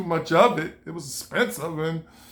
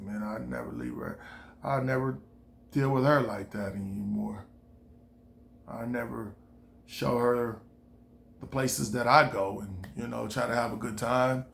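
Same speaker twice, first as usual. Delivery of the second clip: softer, murmured vow; soft resolve; 6 semitones lower, 7 dB quieter than usual. Retold or imitated. Thinking it over, softly vowing to himself. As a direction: say it quietly to yourself.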